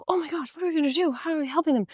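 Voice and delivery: fast, high, breathy fretting parents; mock panic of flustered parents; panicky parents. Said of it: "all the parents started just being like".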